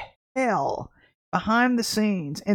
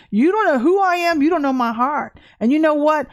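louder, higher, defiant; confronting; 5 semitones higher, 4 dB louder than usual. Real, not acted. To the critics in church, a loud defiant rebuke. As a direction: push back hard.

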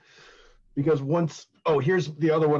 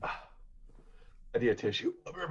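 breathless; 2 semitones lower, 9 dB quieter than usual. Real, not acted. Breathless after laughing.

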